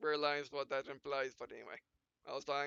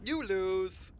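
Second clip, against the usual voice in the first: smug taunt; 4 semitones higher, 10 dB louder than usual. Acted. Pilot finishing off rival.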